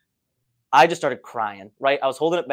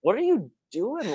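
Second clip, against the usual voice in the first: much higher, puzzled tourist voice; baffled tourist; 9 semitones higher than usual. Retold or imitated. Tourists staring at the filthy crying runners, much higher and baffled. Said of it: "they just give us that, look".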